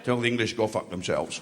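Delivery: low, deadpan royal command; Sean Connery. Acted. As a fantasy King of Scotland, low film-star impression. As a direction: drop the voice deep and give the order. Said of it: "should be played by Sean Connery".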